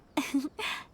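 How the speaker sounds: a small, amused laugh at herself; gentle chuckle, a little embarrassed. Acted. Joking about mother's inner child.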